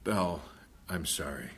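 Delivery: low and hushed, sorry; quiet tender sorry; soft apology. Acted. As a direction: gently apologise to your wife. Husband apologising softly.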